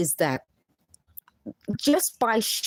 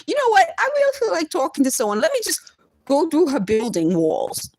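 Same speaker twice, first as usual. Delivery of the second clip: high, sing-song mock of a neighbor who fancies a chat; mock chatty neighbor; 7 semitones higher than usual. Mocking the people she says talk through her walls, high and chatty. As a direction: play it up as a silly, nosy busybody.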